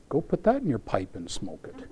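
usual speaking voice

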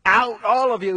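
high and loud "out"; cried command. Acted. Joseph ordering his attendants out, crying it loudly. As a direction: send the servants out with a loud cry. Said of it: "he cried to his attendants".

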